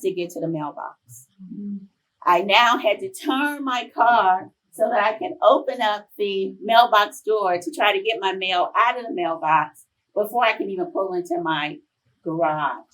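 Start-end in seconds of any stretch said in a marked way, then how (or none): none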